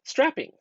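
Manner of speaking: strapping said high and bright with a lift; showing off the new word strapping; target word stressed. Shown as a demonstration. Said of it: "create the word".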